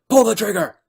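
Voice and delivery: high, loud shouted command; retold yell. Jack yelling at Chloe to shoot him, loud shout. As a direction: bark it like an order at gunpoint. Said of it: "he's just yelling at her".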